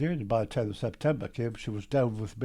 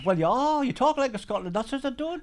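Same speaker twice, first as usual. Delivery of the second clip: retold squabble, pitched well up; mock indignant protest; 11 semitones higher, 4 dB louder than usual. Geordie retells locals insisting he's Scottish, mock indignant. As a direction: bounce both sides of the argument, higher and hammed-up.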